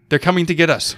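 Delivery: frantic yelling in terror; panicked shout. Retold or imitated. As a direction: yell it in a panic.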